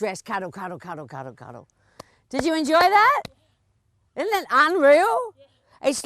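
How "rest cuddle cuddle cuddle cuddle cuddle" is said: fading soothing coo, softer and lower; gentle settling voice that dies to almost nothing; soothing cuddle. Real, not acted. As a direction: let it melt away into a hush. Coach settles horse and rider.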